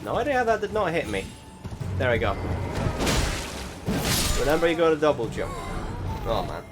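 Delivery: ordinary talk, nothing marked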